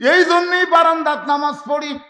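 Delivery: high, loud, protesting wail; distressed outcry. Acted. Rakhal in panic over his mistake, high loud outcry.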